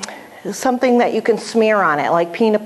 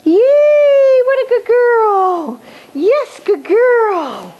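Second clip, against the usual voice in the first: high, loud, stretched-out cheer; celebrating praise; 14 semitones higher, 4 dB louder, much slower than usual. Shown as a demonstration. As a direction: throw a slow party in your voice. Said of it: "Ask, receive, and celebrate".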